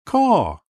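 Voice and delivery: piercing bird caw; crow caw. A narrator's reading. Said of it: "Do you caw too".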